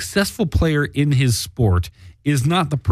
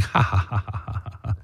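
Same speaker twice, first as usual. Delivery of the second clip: fake, spelled-out laugh trailing away softer; mock laughter; 8 dB quieter than usual. Imagined listener laughing at the hosts' mishap, mock laughter. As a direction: pretend to laugh at someone's blunder. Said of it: "laughing at us like".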